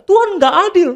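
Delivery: high-pitched mock cry of complaint; retold cry to God. Preacher retelling how he cried out to God, high mock protest. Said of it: "Saya juga kadang teriak".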